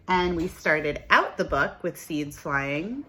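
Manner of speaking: plain reading voice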